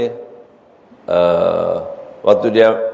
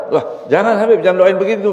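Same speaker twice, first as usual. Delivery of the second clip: alarmed father; 7 semitones higher than usual. Acted. Father begs Habib not to pray.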